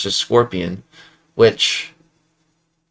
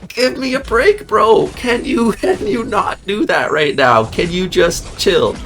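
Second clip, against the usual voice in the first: exasperated pleading; 8 semitones higher, slower than usual. Retold or imitated. Gamer ranting at his opponent's Bishop, high exasperated pleading. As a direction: beg the opponent to stop, half laughing. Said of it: "I'm like".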